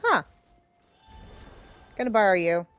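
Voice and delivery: ordinary talk, nothing marked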